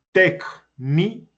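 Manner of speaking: técnicas slowly in pieces, téc-ni-cas; syllable by syllable. Shown as a demonstration. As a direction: break técnicas into three separate beats. Teacher splits word into syllables. (técnicas separada en sílabas, despacio)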